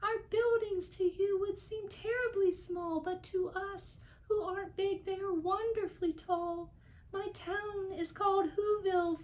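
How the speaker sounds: very high tiny voice; tiny mayor of Whoville. A narrator's reading. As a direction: speak like the little mayor, proud of his town. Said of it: "called the voice".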